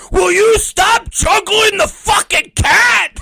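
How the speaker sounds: high, loud, slow mock shout; hammed-up angry boss yelling at him; slow furious mock holler; exasperated yell. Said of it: "And Schlack's like".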